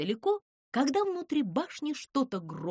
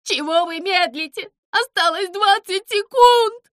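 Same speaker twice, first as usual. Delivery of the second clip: high, very loud panic; frantic scream; 10 semitones higher, 11 dB louder than usual. A narrator's reading. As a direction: yell it in a voice not your own. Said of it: "не своим голосом крикнул Зыза".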